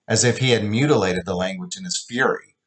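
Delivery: plain reading voice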